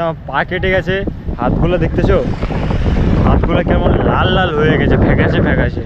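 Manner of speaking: loud, exasperated complaint. Real, not acted. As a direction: vent loudly about the cold. Complaining about cold swim.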